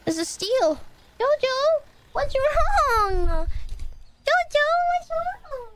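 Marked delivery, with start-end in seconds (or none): 1.2-5.7 high cooing voice for the cat; pet talk. Real, not acted.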